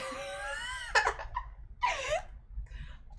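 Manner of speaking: real helpless high laugh; long, high cackle of amusement; high-pitched laughter. Real, not acted. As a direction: burst into squealing laughter. Laughs at her own diary.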